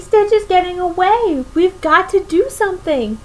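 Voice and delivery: high, urgent panic; panicked. A narrator's reading. Pleakley urging Jumba to chase Stitch, high and anxious. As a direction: push it out high and worried. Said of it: "cried Pleakley".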